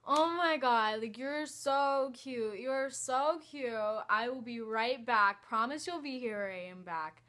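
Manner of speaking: put-on slow, sing-song ditzy drunk girl; stupid drunk voice. Faking drunk to the guy to escape, slow and ditzy. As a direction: play the silly drunk to get away. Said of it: "put on my stupidest, drunkest voice".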